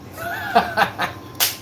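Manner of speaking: real laugh breaking up high; high squealing laugh. Real, not acted. Streamer cracking up at his Boston impression, high squeal laugh. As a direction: cackle at his own bit.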